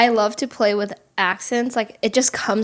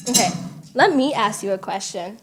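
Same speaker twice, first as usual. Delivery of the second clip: usual speaking voice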